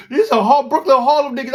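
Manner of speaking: high, loud urgent warning; rally the Brooklyn guys, fast; urgent call. Retold or imitated. Warning Brooklyn crew about Harlem, loud and urgent.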